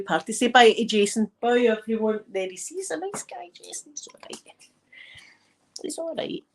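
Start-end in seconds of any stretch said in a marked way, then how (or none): 2.3-6.4 soft, breathy whisper about him; whispered aside. Real, not acted. Teasing aside about Jason, whispered softly. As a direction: whisper it so he can't hear.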